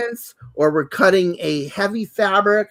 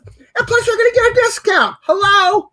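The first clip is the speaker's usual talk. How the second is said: high, fast, gushing pitch with a loud Hello at the end; excited sales pitch; 12 semitones higher, 4 dB louder than usual. Real, not acted. Host pitching a blade discount to viewers, excited and high. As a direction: sell it like the best deal of the year.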